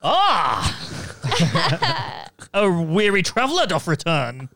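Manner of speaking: put-on loud, theatrical old English voice; old-timey traveller. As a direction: announce yourself like a weary wanderer. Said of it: "give us a little tease of your character".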